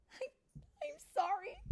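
high, halting, mock-tearful voice; tearful apology. Tink to the queen after ruining spring, tearful. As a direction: stammer it out, close to crying.

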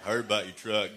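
low-key Southern truck guy; concerned Texan buddy; Texan good ol' boy. Retold or imitated. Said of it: "I heard these two guys talking".

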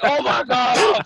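high, slow, loud mortified gasp; a hunter realising his blunder, slow and high. Retold or imitated. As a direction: drag it out in horror. Embarrassed hunter voiced.

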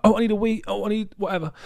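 high, loud mock-whiny voice; mocking whiny friend. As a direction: whine it like the friend always does. Mocking friend's excuses again.

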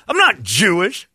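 high, loud, slow blurted denial; retelling his first panicked reply to the man. Retold or imitated. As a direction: blurt it out defensively. His first wrong reaction.

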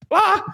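high startled shriek; panicked scream. Retold or imitated. Shrieking when Kelly Dodd calls. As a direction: shriek in shock at the phone.

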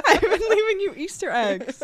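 loud, high, bursting with laughter; laughing exclamation. Real, not acted. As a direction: exclaim it through a real laugh.

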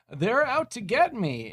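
lightly hammed paranoid king line; imitating the king. Retelling the king's plea in a mock-worried voice. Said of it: "he's like".

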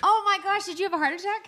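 high, loud gasp of shock. Real, not acted. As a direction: sound truly alarmed. Hears the bride was late.

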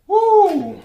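a loud, high whoop of delight; excited whoop. Real, not acted. Thrilled reader whooping at the jumping card, loud and high. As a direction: cheer as a card leaps out. Exclaims at the cards.

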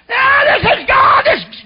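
high, loud, slow mock cry; mocking fake ecstasy. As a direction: ham up a fake holy shout. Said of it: "She was making fun".

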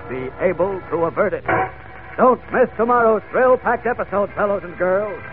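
high, loud thrilling serial-announcer teaser; excited announcer. Acted. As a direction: build the suspense like an old radio announcer. Serial narrator's cliffhanger teaser.

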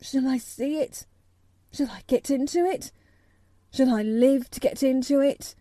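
high, rising, desperate questions; half-sobbing plea. A narrator's reading. Colin begging Mary about the garden, crying out. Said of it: "cried out with a half sob".

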